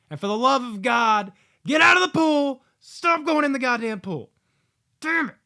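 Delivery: loud, high, fed-up yelling; exasperated shouting. Acted. Reviewer yelling at characters to leave the pool, exasperated. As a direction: bellow it at the characters on screen.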